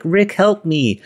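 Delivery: desperate plea. Retold or imitated. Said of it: "And he's like".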